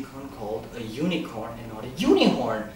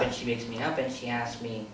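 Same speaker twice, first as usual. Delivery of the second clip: ordinary talk, nothing marked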